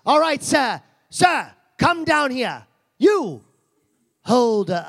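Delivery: high, loud call across the room. Real, not acted. Host calling the volunteer down, loud and high. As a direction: summon him down from the seats.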